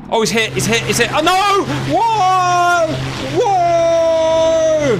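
high alarmed shouting; man down shout. Real, not acted. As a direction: yell as the plane goes down. Plane hit, crashing.

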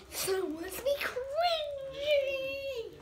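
high, slow put-on voice; cringy voice. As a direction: drawl it out cringily. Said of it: "being cringy".

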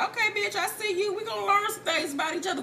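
high, playful voiced side-eye; knowing approval. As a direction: act out two new friends sizing each other up. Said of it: "give each other a eye like".

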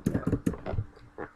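breathed no, no voice; defeated whisper. Real, not acted.

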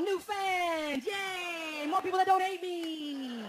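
high, loud squeal of delight; hammed-up giddy excitement; mock squealing fan. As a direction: gush like an overjoyed kid. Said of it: "That's how his emails sound to me".

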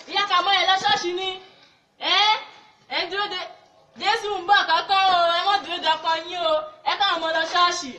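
loud evangelist's exhortation; street preaching. Acted. Evangelist urging mothers to church, loud street preaching. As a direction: call out urgently to passing neighbours.

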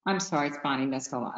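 ordinary talk

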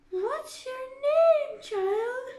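high, loud, slow queen's demand; imperious queen. A narrator's reading. Queen questions Alice, imperious and high. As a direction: loom over the child as the Queen. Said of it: "She turned to Alice and said".

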